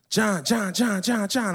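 high, loud repeated name call; rapid-fire excited calling. Retold or imitated. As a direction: imitate a friend urgently calling from a balcony. Friend calling urgently from balcony.

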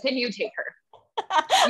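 slow, laughing through the words; slowed-down giggling comeback; laughing retort. Pretend. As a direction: laugh it out as a joke offer. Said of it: "And I'm gonna be like".

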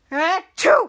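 loud mock sneeze; exaggerated acted sneeze. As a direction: fake a big sneeze at him. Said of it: "And he sneezes on the guy".

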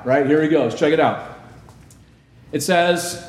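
ordinary talk

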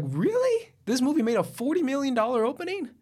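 high-pitched disbelief; can't-believe-it squeal of a question; incredulous. Retold or imitated. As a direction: question it in soaring, mock-astonished pitch. Acted incredulous reaction to hype.

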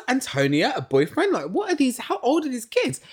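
mock horrified surprise going up high; shocked disbelief. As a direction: retell your startled reaction, pitch climbing. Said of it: "and I was".

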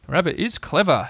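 mildly pondering storybook bear; thoughtful. A narrator's reading. Bear reflects on Rabbit to Piglet, thoughtful. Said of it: "said Pooh thoughtfully".

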